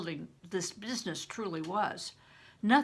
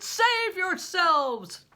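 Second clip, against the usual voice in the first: slow, loud, high cry; thundering command; 10 semitones higher, 9 dB louder, much slower than usual. Acted. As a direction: order them to flee, booming. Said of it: "John Ball thundered".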